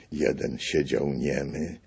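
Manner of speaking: plain reading voice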